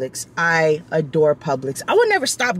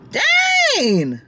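retold yelp. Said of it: "I'm like".

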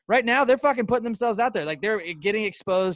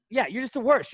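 ordinary talk, nothing marked

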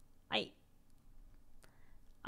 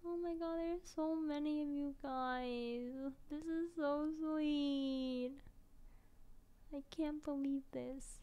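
moved near tears; 4 semitones higher, slower than usual. Real, not acted. Moved by fan messages.

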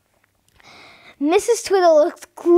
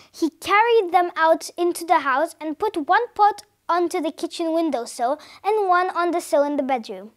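ordinary delivery, nothing marked

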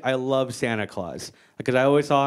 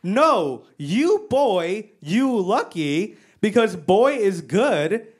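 slow, raised-pitch broken English; imitated mom; 4 semitones higher, much slower than usual. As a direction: lecture simply, stretching each word. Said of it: "And she goes".

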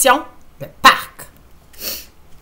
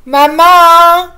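high, loud yell for mom; shout; 9 semitones higher, 14 dB louder than usual. Acted. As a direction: scream it across the yard. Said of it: "Et il crie". (cri aigu et fort)

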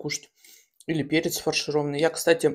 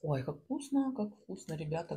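talking while chewing; mukbang eating; 11 dB quieter than usual. Real, not acted. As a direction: mouth full of food. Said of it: "Ну, это мукбанг, ребята".

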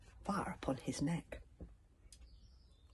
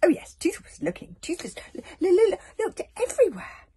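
high, loud stammer; guilty stammering dragon; 14 dB louder than usual. A narrator's reading. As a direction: trip over the l's guiltily. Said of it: "started guiltily".

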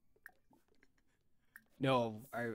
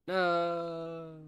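higher, louder cry of no; 7 semitones higher, 9 dB louder than usual. Real, not acted. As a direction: wail it in protest. Protesting the sim going to bed.

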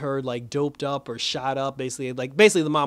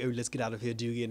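lower, softer, menacing villain voice; the Shade ominous; 3 semitones lower, 7 dB quieter, faster than usual. Retold or imitated. The Shade warning Pat about the Shadowlands, low and hushed. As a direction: sink the voice low and quiet like a threat. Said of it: "he was like".

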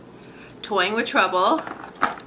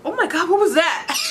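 higher, louder startled question; 8 semitones higher, 4 dB louder than usual. Real, not acted. Something unexpected in the OPI box, a startled higher cry. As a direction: caught off guard by something.

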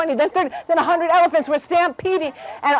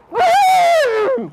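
high, loud trumpeting whoop; elephant trumpet; 4 dB louder than usual. A narrator's reading. Alarmed elephant hearing the news, trumpeting. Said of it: "And he trumpeted".

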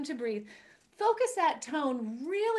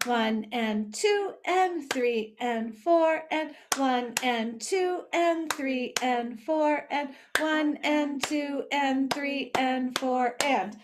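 slow counting; 2 semitones lower, slower than usual. Shown as a demonstration. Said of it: "teacher counts the beat".

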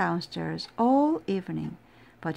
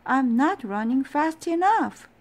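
higher, louder frantic complaint; 6 semitones higher, 6 dB louder than usual. Acted. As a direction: push it out in a frantic rush. Frantic Owl running stairs.

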